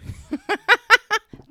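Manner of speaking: loud, high-pitched laugh; burst of laughter. Real, not acted. Co-host reacts to the clothing dare, bursting out laughing.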